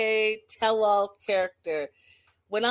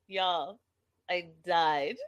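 ordinary delivery

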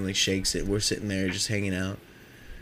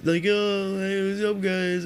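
high, sugary fake-friendly voice; mock the beer thieves with a phony sweet high hello; mock fake-nice greeting; 9 semitones higher, slower than usual. Beer thieves greeting them with a mock high fake-nice hello. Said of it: "being all fucking stupid shit, trying to be nice".

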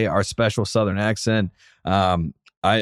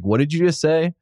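imitating a puzzled girlfriend, higher pitch; mock-confused, pitched up on the question; baffled question; 7 semitones higher than usual. Girlfriend from Seattle asks him to repeat, baffled.

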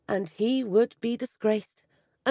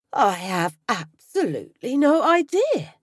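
defensive denial; 3 semitones higher, 5 dB louder than usual. A narrator's reading. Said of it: "confidence returning to his voice".

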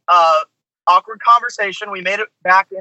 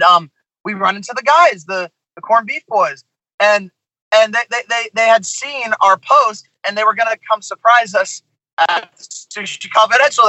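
usual speaking voice

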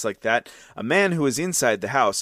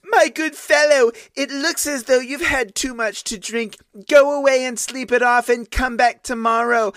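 mocking maidservant; 11 semitones higher, 5 dB louder than usual. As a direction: brush him off like a drunk at the door. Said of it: "And her answer was".

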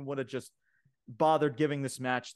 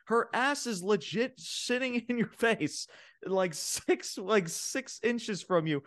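incredulous, high-pitched mock rant; Corey Graves; 8 semitones higher than usual. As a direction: act out an exasperated commentator scolding his partner. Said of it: "Corey Graves is like".

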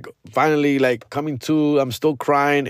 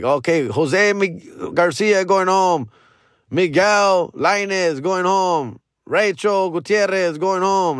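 higher, slow, drawn-out roll-call announcement; teacher calling out names; 6 semitones higher, slower than usual. Retold or imitated. Teacher calling out pupils going home, slow announcing call. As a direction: call each name out slowly to a whole yard. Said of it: "the teacher calling out everyone else's name".

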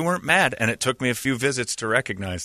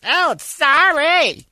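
put-on sheepish kid; 5 dB louder, much slower than usual. Kid caught on the wall.

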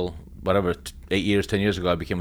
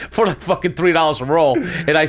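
laughing, a bit higher and louder; laughing through words; 7 semitones higher, 4 dB louder than usual. Real, not acted. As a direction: chuckle it out at the memory of cheap drums. Laughing at his cheap drums.

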